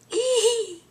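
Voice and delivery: very high squeal; fangirl squeal. Pretend. Starstruck fan squealing at Jesse's thanks, very high. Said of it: "I didn't do that".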